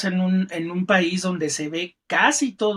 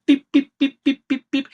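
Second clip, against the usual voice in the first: high, louder little pips; mock pip noises, pitched up; playful pip sounds; 7 semitones higher, 3 dB louder than usual. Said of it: "es como pip".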